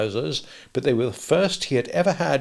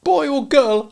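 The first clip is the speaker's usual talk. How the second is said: not too hopefully; 14 semitones higher, 5 dB louder, slower than usual. Acted. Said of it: "not too hopefully".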